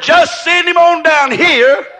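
a prophet calmly sending for the sick man; Elisha's calm reply. Acted. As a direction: lay it down slowly like a sure prophet. Elisha's confident answer to the king.

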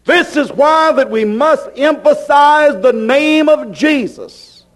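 usual speaking voice

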